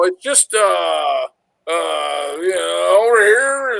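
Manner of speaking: imitating a stalling drunk, drawn-out fillers; fumbling drunk explanation. Drunk driver trying to explain to the trooper where home is, fumbling. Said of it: "I'm trying to explain to him".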